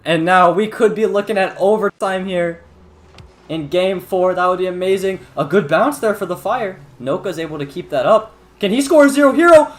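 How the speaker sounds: high, loud play-by-play; esports caster. Real, not acted. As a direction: call the overtime hype. Live play-by-play at full pitch.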